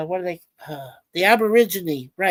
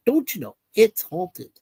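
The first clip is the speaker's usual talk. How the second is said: put-on Aussie accent. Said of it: "Not my best Aussie accent".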